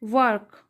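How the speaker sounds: "वर्क" with the R barely sounded; "वर्क" falling, with a very light R; correct pronunciation. Shown as a demonstration. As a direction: glide past the R in "वर्क". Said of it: "आर का sound ये बहुत lightly है".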